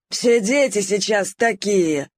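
slow, put-on old dog's grumble; rueful grumble. Old poodle grumbling about today's youngsters. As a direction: grumble it with a sigh of disapproval. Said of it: "сокрушённо проворчал пудель Боб".

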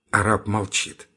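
ordinary delivery, nothing marked

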